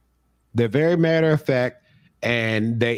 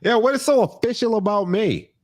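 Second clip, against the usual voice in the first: mock offended officiant; 8 semitones higher than usual. Mock officiant retort, high.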